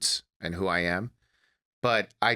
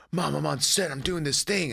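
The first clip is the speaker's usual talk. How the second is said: excited son; 6 semitones higher than usual. Retold or imitated. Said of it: "I'd be like".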